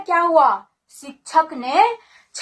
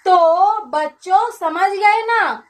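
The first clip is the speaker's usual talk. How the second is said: flustered teacher covering up; 5 semitones higher than usual. Acted. Sleeping teacher caught by collector, covers.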